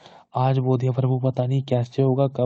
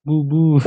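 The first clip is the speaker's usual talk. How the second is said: put-on playful baby boo; 3 semitones higher, 6 dB louder, much slower than usual. पिता बच्चे को बहलाता है.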